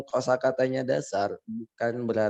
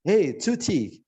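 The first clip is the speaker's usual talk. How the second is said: high, hammed-up English accent on Indonesian; over-the-top English accent; 10 semitones higher than usual. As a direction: overdo the English accent, pitched way up. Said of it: "lebaynya harus bahasa Inggris".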